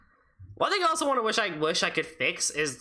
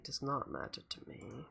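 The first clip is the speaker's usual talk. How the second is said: much softer, almost breathed; quiet; 5 semitones lower, 15 dB quieter than usual. Shown as a demonstration. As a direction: say it again very softly.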